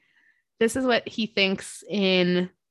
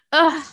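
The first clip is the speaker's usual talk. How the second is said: groan; 9 semitones higher, 6 dB louder than usual. Real, not acted. Sad reaction.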